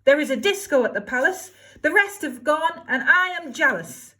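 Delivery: high, loud demanding shout; shouting demands. Acted. Cindy shouting her demands at the fairy. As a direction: bawl out every want. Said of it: "and shouted".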